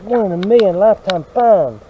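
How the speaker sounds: loud, thrilled exclamation; pumped. Real, not acted. Showing viewers a jar find, loud and thrilled. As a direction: shout it out like a lifetime find. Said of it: "I'm getting pumped about this jar thing".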